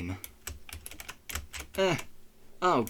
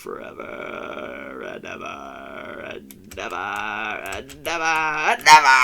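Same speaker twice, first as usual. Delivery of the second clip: mock despairing wail; 2 semitones lower, 6 dB louder than usual. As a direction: wail in hammed-up despair, getting louder. Mock despair, stuck on level.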